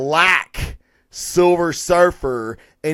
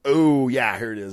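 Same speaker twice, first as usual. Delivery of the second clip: normal talking voice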